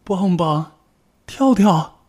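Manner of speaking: high, worried granny calling out from another room; anxious high call from the bedroom. Acted. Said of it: "担心地呼喊着".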